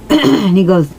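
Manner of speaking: ordinary talk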